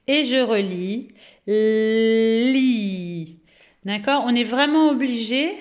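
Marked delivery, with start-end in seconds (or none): 1.5-3.4 "Li" with very long L and i; exaggerated pronunciation. Shown as a demonstration. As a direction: read "Li" slowly, gliding from L to i. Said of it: "d'exagérer la prononciation". (« Li » très étiré, sons liés)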